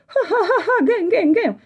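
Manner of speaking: very high honking laugh; goose honking laughter. Acted. Goose Gęgula mocking the dogs, honking laugh. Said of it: "gęgała gęś Gęgula".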